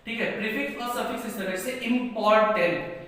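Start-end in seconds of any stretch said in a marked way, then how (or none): 1.9-3.0 इंपॉर्टेंट broken into three beats, im-por-tent; split into syllables. Shown as a demonstration. Teacher counting three syllables, इंपॉर्टेंट in separate beats. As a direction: sound out इंपॉर्टेंट one syllable at a time. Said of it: "तीन syllable का हो गया".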